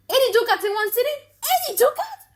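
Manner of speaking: high, fast, incredulous squeal; can't believe the sum he hears; shocked outrage. Acted. Shylock hearing what his daughter spent in Genoa, high shocked squeal.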